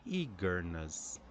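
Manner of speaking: "eagerness" slowly, one strong EA, two lighter syllables; first-syllable stress. Shown as a demonstration. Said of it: "one strong syllable followed by".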